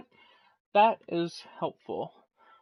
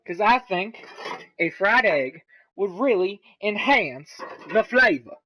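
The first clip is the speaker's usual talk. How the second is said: higher, louder, emphatic lecture; stern lecturing mother; 5 semitones higher, 5 dB louder than usual. Real, not acted. Home cook explaining the fried egg, stern motherly lecture. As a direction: lecture it firmly like a stern mother, stretching key words. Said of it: "giving a stern speech about something".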